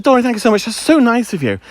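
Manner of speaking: gushing thanks. Retold or imitated. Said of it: "I said".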